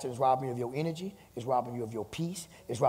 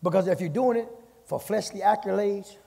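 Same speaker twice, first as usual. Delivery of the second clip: higher preaching build; 4 semitones higher than usual. Acted. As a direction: press on each phrase. Preaching at full force.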